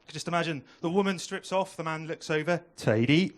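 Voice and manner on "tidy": mock Welsh, low and loud; hammed-up low Welsh voice; Welsh accent. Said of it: "The best British accent there is".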